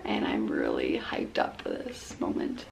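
breathy excited whisper. Real, not acted. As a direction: whisper it like a secret. Hushed aside about being hyped.

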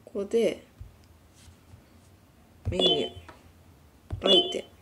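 usual speaking voice

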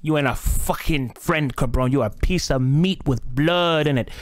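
slow, loud threat; menacing boss. Acted. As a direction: growl it like a cartel heavy. Cartel boss threatens the bard.

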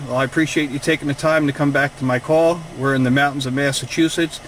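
usual speaking voice

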